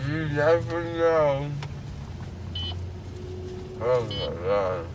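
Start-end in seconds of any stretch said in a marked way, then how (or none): none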